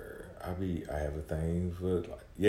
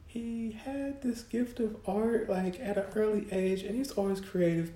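imitating mom. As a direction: play his proud mom bragging to the camera. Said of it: "My mom was talking about".

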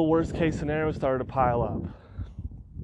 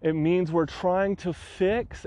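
ordinary talk, nothing marked

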